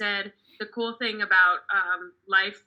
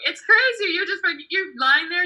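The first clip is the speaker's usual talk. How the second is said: higher, louder, excited burst; 8 semitones higher, 5 dB louder than usual. Real, not acted. Comic to the audience, excited burst. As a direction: blurt it out all worked up.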